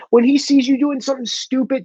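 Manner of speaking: ordinary delivery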